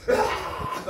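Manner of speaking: sudden harsh imitated shriek; acted-out shriek of the possessed; possessed scream. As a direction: let out a demon's scream. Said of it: "하면 입에서 얘기하는 거야".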